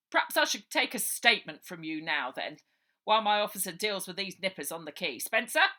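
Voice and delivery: loud, officious copper calling out; old sergeant summoning his constable; pompous sergeant. Acted. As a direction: bark orders like a fussy old policeman.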